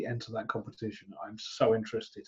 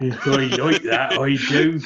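bad Somerset accent, a bit louder; hammy West Country oi, like that; 7 dB louder than usual. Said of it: "mine was the worst Somerset accent ever".

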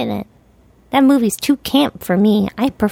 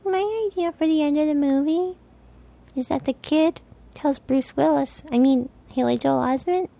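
cat's voice; 6 semitones higher, 5 dB quieter than usual. Pretend. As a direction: speak as the cat, softly and oddly. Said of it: "[name] said that".

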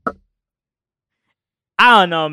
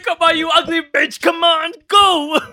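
gym coach yelling; 9 semitones higher, slower than usual. Retold or imitated. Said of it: "he's like".

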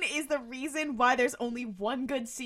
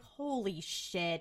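stunned; 6 semitones lower, 7 dB quieter, much slower than usual. Retold or imitated. Stunned reaction to the backstory.

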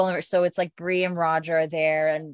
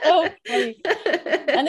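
giggling while talking; laughing; 9 semitones higher, 3 dB louder, slower than usual. Real, not acted. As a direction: laugh through the words. Laughing commentary.

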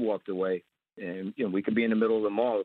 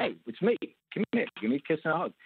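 higher, bright friendly call across the field; eager greeting; 6 semitones higher, faster than usual. Retold or imitated. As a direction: hail an old pal, arms open. Greeting his mob contact warmly.

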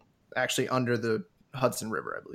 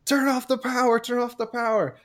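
high, louder imitation of screaming passengers; retold panicked scream; 11 semitones higher, 3 dB louder than usual. Passengers screaming at the train conductor, retold high-pitched yell. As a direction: mimic people screaming for help, pitched way up. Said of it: "They're screaming at the conductor".

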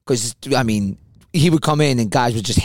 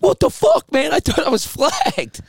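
high, slow, stunned disbelief, much higher; shocked outburst. Retold or imitated. Bob realizing the cut-off was a prank, high stunned outburst. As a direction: squeal the disbelief as the prank lands. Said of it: "He's like".

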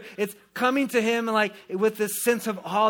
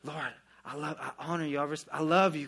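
tender prayer; 6 semitones lower, 8 dB quieter than usual. Retold or imitated. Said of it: "who come to him with this".

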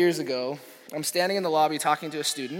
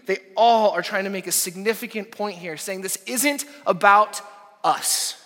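loud, high, driving preacher's voice; preaching at full force; 5 semitones higher, 6 dB louder than usual. Acted. Pastor preaching to his church, full force. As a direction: pound it home to the congregation.

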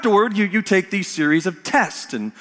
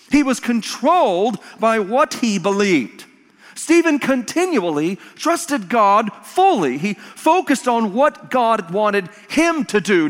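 raised, driving pulpit delivery; emphatic preaching; 6 semitones higher, 4 dB louder, slower than usual. Real, not acted. Preacher telling of Stephen's faith, emphatic preaching. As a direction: preach it with slow weight on key words. Preacher builds his point forcefully.

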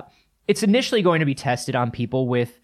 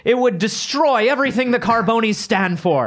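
proud family patriarch; 6 semitones higher than usual. Acted. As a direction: boast about the family's sickly heritage like a clan chief. Boasting mock family heritage.

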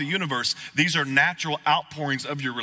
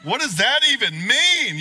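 baffled; 10 semitones higher, 6 dB louder than usual. Retold or imitated. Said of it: "And you're like".

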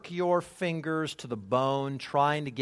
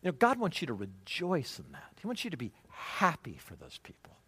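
a softer voice for mom, gently correcting; mom's gentle rebuke; 7 dB quieter than usual. Retold or imitated. Mom gently corrects her envious son, softly. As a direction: quietly coax the boy to be happy for them. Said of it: "My mom's like".

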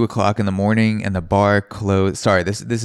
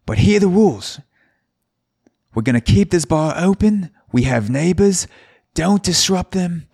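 loud, much higher hammed-up Australian bartender; terrible Australian accent; 11 semitones higher, 5 dB louder than usual. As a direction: bellow the bar rules in a bad Australian accent. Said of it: "bear with my Australian accent, 'cause it's gonna be terrible".

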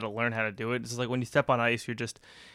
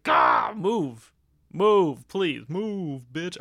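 loud, higher yelling Move; mock yell; 4 semitones higher, 6 dB louder, much slower than usual. At skaters who cut in front, a loud mock yell. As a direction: shout them out of the way.

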